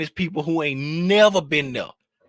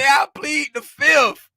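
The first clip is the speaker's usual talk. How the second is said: quoting [name]; 10 semitones higher, 8 dB louder, slower than usual. Retold or imitated. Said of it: "[name] say".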